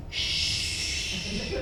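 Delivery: soft unvoiced shush; hushing. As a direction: hush her like a secret. Choir director hushing her.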